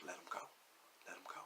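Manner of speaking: very soft, low-key command; quiet calm order. Retold or imitated. Cop taps him on the shoulder, very quiet order. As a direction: say it hushed, almost under the breath.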